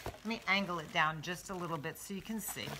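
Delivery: ordinary talk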